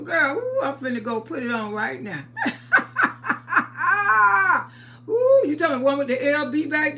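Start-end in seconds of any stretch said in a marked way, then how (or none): 2.3-4.7 甲高く長い笑い; loud high laugh. Real, not acted. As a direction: cackle long and high. Laughing at viewer.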